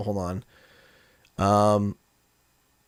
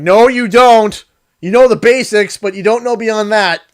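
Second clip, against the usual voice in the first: dismissive retort; 8 semitones higher, 10 dB louder than usual. Retold or imitated. Retorting to commenters in retold exchange.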